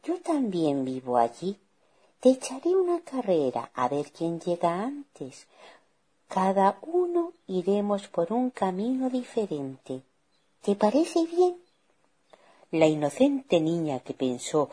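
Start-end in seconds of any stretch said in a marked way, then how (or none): 0.0-11.6 lightly voiced sly wolf, sweetly persuasive; wheedling wolf, ending high; cunning wolf. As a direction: coax the child into a game. Wolf proposes a race.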